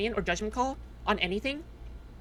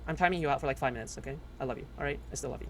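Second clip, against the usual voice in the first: much softer, lower, gentle make-up; softened reassurance; 9 semitones lower, 9 dB quieter, faster than usual. Real, not acted. Streamer gently reassuring a timed-out chatter, soft and low. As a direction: soothe the viewer you just punished.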